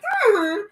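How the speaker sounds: high imitated dog "huh?"; puzzled dog noise. Puzzled dog reacting to its owner slowing down, high mock whine. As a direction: make a high, questioning dog sound. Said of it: "your dog might like".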